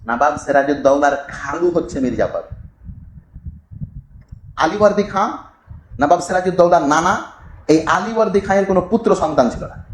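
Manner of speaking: normal talking voice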